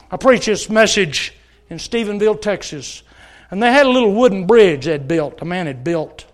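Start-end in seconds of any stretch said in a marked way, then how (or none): none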